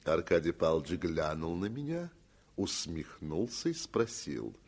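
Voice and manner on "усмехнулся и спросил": ordinary delivery, nothing marked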